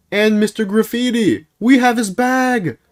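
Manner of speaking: high squeal building to a yell; mock panic. Storyteller fearing capture with the stolen bag, exaggerated high panic. Acts out fear of getting caught.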